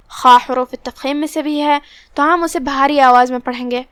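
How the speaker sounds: ordinary talk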